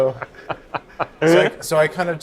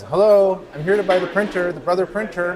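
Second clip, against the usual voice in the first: higher, louder call into a house; tentative visitor's hello, pitched up; 7 semitones higher, 4 dB louder than usual. Retold or imitated. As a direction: act out announcing yourself at the door. Retold calling into stranger's house.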